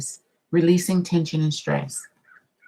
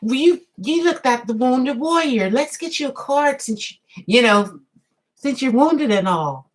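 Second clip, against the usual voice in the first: higher playful imitation of a TV boy; Leave It to Beaver; 7 semitones higher than usual. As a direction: play the wholesome fifties TV kid. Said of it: "So Leave It to Beaver goes".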